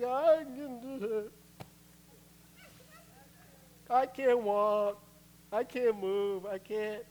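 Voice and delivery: slow, high, drawn-out moan; hammed-up helpless whining; Sadness. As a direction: drag each word out in despair. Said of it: "sadness. She's like".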